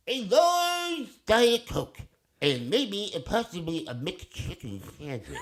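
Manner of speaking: high, slow, drawn-out silly voice; silly high-pitched ordering voice; silly put-on voice. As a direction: order food in a high, goofy, stretched-out voice. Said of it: "could not stop doing the voice".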